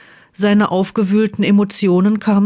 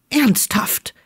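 irritated snap; 4 semitones higher than usual. A narrator's reading. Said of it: "schnauzte er gereizt".

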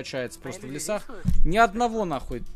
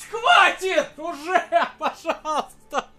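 raging gamer; 13 semitones higher, 5 dB louder, slower than usual. Real, not acted. Gamer begs in frustration.

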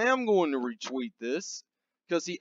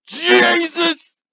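much higher, louder yell; exasperated shout; 13 semitones higher, 9 dB louder than usual. Real, not acted. Host yells at the stupidity, loud and high. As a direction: cry out in disbelief.

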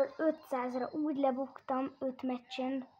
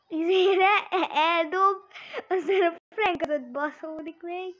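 excited; 4 semitones higher, 8 dB louder than usual. Real, not acted. Excited gaming commentary.

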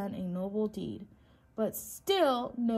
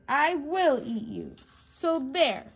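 raised, loud wolf's threat; angry roar; 5 semitones higher, 5 dB louder than usual. A narrator's reading. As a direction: roar the threat with childish indignation. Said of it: "he roared".

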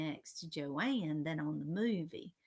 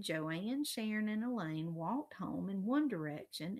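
ordinary delivery, nothing marked

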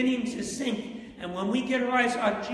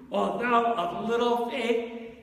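Jesus' rebuke; 3 semitones higher, 4 dB louder, slower than usual. Retold or imitated.

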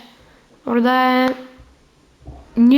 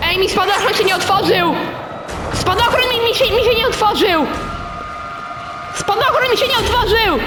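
panicked yelling; 7 semitones higher than usual. Real, not acted. Parachute fails to open in game.

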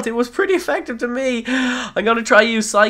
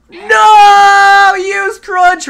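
high, loud cry of alarm; panicked shout; 11 semitones higher, 10 dB louder, much slower than usual. Real, not acted. Gamer panicking as his Pokemon is hit, shouting. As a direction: yell out in battle panic.

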